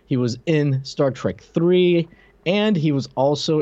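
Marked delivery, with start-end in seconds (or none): none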